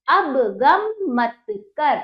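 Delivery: put-on slow, smooth reading for children; whole sentence read. Shown as a demonstration. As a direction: join the words into one slow sentence. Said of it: "teacher then reads sentence whole". (पूरा वाक्य धीरे-धीरे जोड़कर)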